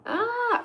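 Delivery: high, drawn-out "ah" of delight; delighted squeal. Real, not acted. Thrilled at viewers from all over the world, delighted squeal. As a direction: let out a high happy coo.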